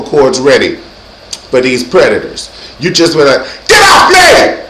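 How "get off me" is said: high, forceful shout; crazy yell. Shown as a demonstration. Shows how to fight off a predator, crazy high yell. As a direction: yell it wildly to drive someone off. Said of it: "you got to yell and be crazy".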